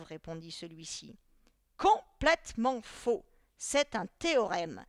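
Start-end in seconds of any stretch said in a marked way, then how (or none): 1.8-4.8 loud, slow, hammered syllables; emphatic correction. A narrator's reading. Teacher rejects a pupil's wrong answer, loudly emphatic. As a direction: correct him emphatically, drawing out each word.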